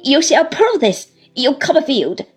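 threatening. A narrator's reading. Uriah threatens Copperfield over the charges, threatening. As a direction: challenge him with a loud threat. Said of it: "with a threatening shake of the head".

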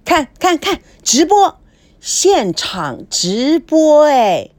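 high, loud, eager calling out; excited shouting. Acted. Roommate bursting in to push the live broadcast, loud and thrilled. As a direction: drag the last words out slowly, thrilled.

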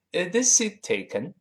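"Is this seat taken" with "this" high and rising; a clear teacher's model question, "this" lifted high. Shown as a demonstration. Teacher models the English question for students, bright rising tone. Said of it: "是这么一个".

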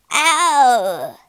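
sharp mock yelp, loud; a quick hammed-up cry of pain. Robber woman bitten on the ear yelps loudly.

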